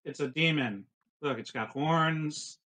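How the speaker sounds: ordinary talk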